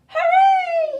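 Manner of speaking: high, loud joyful hooray; excited cry. Acted. Duckling cheering for himself, excited high cry. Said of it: "he cried".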